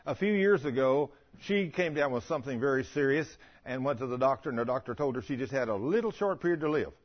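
ordinary talk

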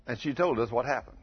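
ordinary talk, nothing marked

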